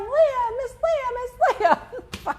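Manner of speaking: high, drawn-out mock yell; imitated yelling. Host imitates people yelling from the car, mock calling out. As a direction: call out the name slowly, pitched high. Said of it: "honking and honking and yelling".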